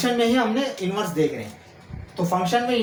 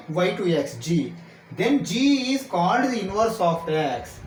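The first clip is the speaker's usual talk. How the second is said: very slow, in short phrases with pauses; stretched-out words, teacher dictating a definition; slow dictation; much slower than usual. Real, not acted. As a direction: dictate it piece by piece for students to write.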